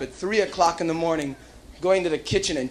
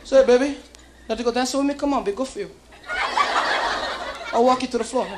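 John Travolta impression; 5 semitones higher, faster than usual. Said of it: "John Travolta".

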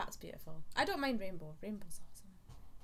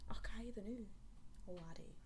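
whispering; 7 dB quieter than usual. Pretend. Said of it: "whispered echo".

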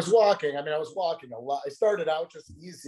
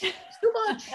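laughing. Real, not acted. Laughing at wife's fast walking.

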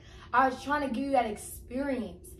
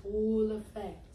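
spooky storytelling; 5 semitones lower, 9 dB quieter, much slower than usual. Acted.

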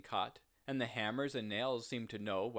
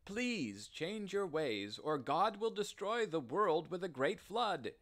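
pleading; 7 semitones higher than usual. A narrator's reading.

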